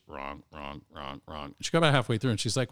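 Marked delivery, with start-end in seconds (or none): 0.1-1.5 softer, lower run of wrong, wrong; murmured wrong, wrong, wrong, wrong; muttered wrongs. Retold or imitated. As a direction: tick off each wrong under the breath. Tester marking quiz answers.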